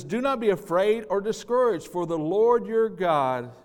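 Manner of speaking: loud proclamation. Acted. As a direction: proclaim it over the congregation. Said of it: "He says".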